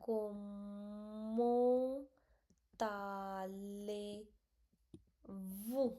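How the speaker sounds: "como" and "tale" drawn out, then "vous" as "vu"; slow syllables. Shown as a demonstration. Teacher sounding out the phrase slowly. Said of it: "So it become".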